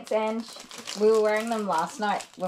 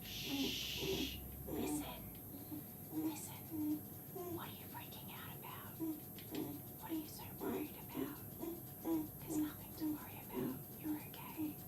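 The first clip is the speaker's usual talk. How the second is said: whisper; 7 semitones higher, 17 dB quieter than usual. Real, not acted. Whispering to calm dog.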